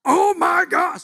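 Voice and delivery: high, loud yell of amazement; re-enacted shriek of awe; astonished shout. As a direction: holler it in stunned excitement. Acts own shock at huge fish.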